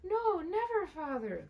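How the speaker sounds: high, loud chorus; children crying out again; children's cry. Acted. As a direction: cry out eagerly together. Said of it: "they all cried again".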